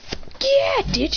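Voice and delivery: very high exuberant cheer; excited boast. Acted. Son bragging to mother on phone, excited cheer. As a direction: shout it proudly, high.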